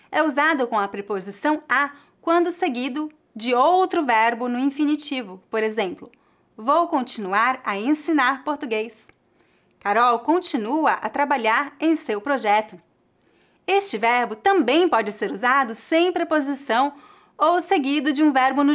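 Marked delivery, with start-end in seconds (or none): none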